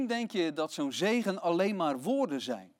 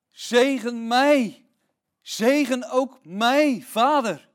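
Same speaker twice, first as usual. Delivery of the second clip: loud, slow, higher pleading cry; desperate plea; 7 semitones higher, 11 dB louder, much slower than usual. Acted. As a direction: beg father with all you have. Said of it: "En hij smeekte zijn vader".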